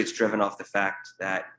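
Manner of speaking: ordinary delivery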